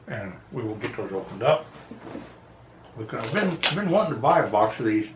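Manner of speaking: talking around a cigar; cigar in mouth. Real, not acted. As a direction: lips clamped on cigar. Seen 1: Cigar held in lips from before the words until removed near 3.1s.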